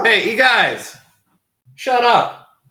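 high, loud yell to hush them; real shout at dogs. Real, not acted. Podcaster breaks off to yell at his barking dogs, shouting. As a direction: yell across the room at noisy dogs.

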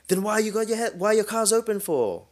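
very high, mock angry shout; imitated accented shouting. Drunk foreigner confronts the driver about his car, mock accented shouting. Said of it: "he starts shouting at me".

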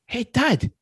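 put-on high-pitched quote of a child; imitated kids. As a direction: pitch it up as your kids calling Dad. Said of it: "They're like".

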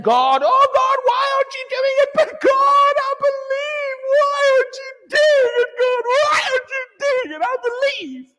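high, wailing mock lament, loud; mock crying prayer. Preacher mocking a believer wailing at God. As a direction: parody a whining believer begging God. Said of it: "Many people think Bible faith is me crying, moaning, saying".

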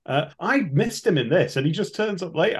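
usual speaking voice

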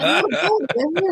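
high-pitched mock Canadian accent; cartoonish Canadian lilt, high; exaggerated Canadian. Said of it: "I don't sound overly Canadian-y".

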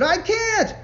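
very high, very loud frantic protest; panicked. A narrator's reading. John shouting back to his friend while fleeing, panicked. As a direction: shout it in terror, running.

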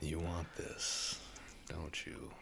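soft teasing whisper; tempting devilish whisper. Pretend. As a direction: whisper it like a devil on his shoulder. Teasing friend about giving five.

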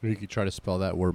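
ordinary talk, nothing marked

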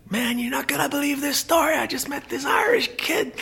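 very high put-on voice, the last line whispered; mock excited caller. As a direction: squeal the news, then drop to a whisper. Retelling the phone call.

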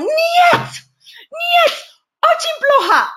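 high-pitched, loud Russian teacher's outburst; shouted rejection. Retold or imitated. Russian acting teacher rejecting a student's scene, mock furious shouting. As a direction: imitate an outraged teacher yelling no in Russian.

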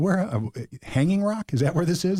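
high, incredulous questioning. Retold or imitated. Driver asking the cop about Hanging Rock, high and incredulous. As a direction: pitch jumps up in disbelief.

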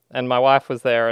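ordinary talk

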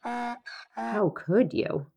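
slow, high, drawn-out donkey whine; complaining donkey. Pretend. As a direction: stretch it out like a sulky donkey. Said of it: "to which they might complain loudly".

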